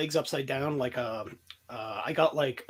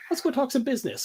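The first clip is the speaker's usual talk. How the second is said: pitched up high, mock-sinister offer; mock shady deal voice; 10 semitones higher, faster than usual. Retelling an overheard deal, high mock-sinister voice. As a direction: play the shady guy agreeing to the hit, high and sly. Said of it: "And he's like".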